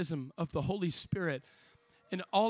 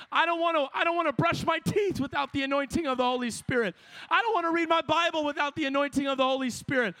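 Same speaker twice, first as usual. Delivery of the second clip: higher and louder, building to a shouted altar call; full-force preaching; 9 semitones higher, 6 dB louder than usual. Acted. Preacher urging young people to seek anointing, shouting fervently. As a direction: preach it hard, calling the crowd to commit.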